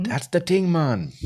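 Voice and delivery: high squeaky fake Turkish accent; bad Turkish accent. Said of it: "That is a really lousy impression".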